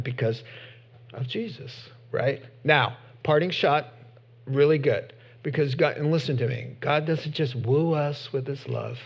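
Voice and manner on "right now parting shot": ordinary talk, nothing marked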